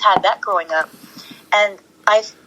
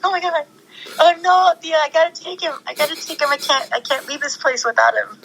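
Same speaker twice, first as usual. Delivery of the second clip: high, laughing, pleading to take the puppy; laughing plea; 7 semitones higher, faster than usual. Retold or imitated. Begging her friend Thea to take the crying puppy home, high laughing plea. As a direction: beg half-laughing, pitched way up.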